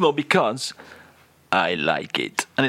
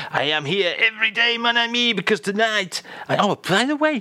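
French persona; 9 semitones higher than usual. Acted. [name] in put-on French persona.

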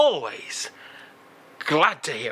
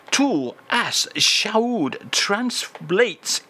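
slow grand reveal; 7 semitones higher, much slower than usual. Acted. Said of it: "grand reveal".

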